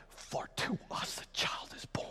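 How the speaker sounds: hushed whisper of the prophecy; awed whispered reading. Acted. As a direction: breathe it out in a whisper.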